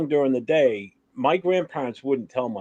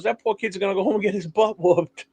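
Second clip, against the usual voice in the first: grandma voice; 8 semitones higher than usual. Retold or imitated. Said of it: "He goes".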